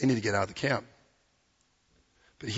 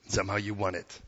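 normal talking voice

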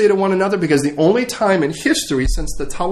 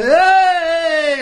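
high, long-held goofy hail; comic showy greeting; 8 semitones higher than usual. Acted. Disheveled disciple steps out to the crowd, goofy hail. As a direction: stretch out a high, clownish greeting.

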